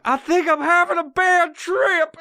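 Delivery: high, loud worried cry; panicked high wail. Retold or imitated. Realizing mid-trip it's going bad, high wail. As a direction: realize with alarm. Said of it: "I'm like".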